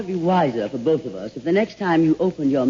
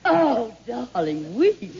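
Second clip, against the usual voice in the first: arch correction; 7 semitones higher, much slower than usual. Acted. Mock-correcting Marlene's "weeks.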